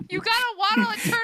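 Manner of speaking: loud, laughing through the words; words breaking with laughter. Real, not acted. GM teasing the player while laughing hard.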